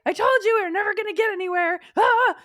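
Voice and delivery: high, loud, fast whining wail; whiny inner critic. Retold or imitated. Inner critic wailing that they'll never get anywhere, high and fast. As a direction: rush it out in an exasperated near-cry. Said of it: "everybody is behind like".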